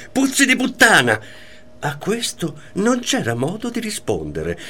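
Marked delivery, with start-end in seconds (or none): 0.1-1.2 imitated jealous shout. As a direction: spit it out like the jealous wife. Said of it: "diceva sempre".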